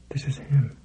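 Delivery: hushed dread. A narrator's reading. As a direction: say it under your breath. Dread realization aside.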